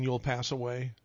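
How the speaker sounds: ordinary talk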